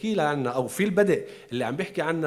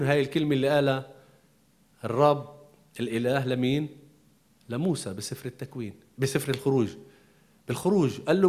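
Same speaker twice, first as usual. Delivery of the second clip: ordinary talk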